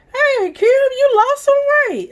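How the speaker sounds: high, loud imitation of dad; dad's voice. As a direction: playfully voice her proud dad. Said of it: "He'd be like".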